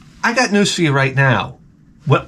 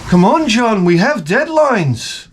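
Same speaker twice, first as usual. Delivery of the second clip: mock English accent; 7 semitones higher, slower than usual. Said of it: "the Beatles fighting in studio".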